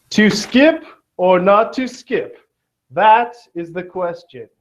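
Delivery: lightly hammed Shakespearean recital; mock Shakespeare. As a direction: declaim it like a hammy Shakespeare. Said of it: "famous quote from Shakespeare".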